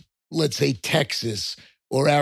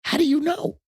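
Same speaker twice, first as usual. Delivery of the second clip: mock disbelief; 14 semitones higher than usual. Real, not acted. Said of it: "teasing challenge".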